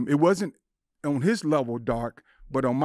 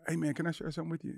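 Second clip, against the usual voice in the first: quick soft ask; 4 semitones higher, 7 dB quieter, faster than usual. Retold or imitated. Imagined opener, fast and soft.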